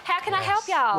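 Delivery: a hammed-up American phone greeting; Southern American accent. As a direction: put on a Southern American accent to help a caller. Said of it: "speak to an American".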